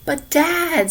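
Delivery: put-on high, pleading boy's voice; a kid begging his dad; child voice.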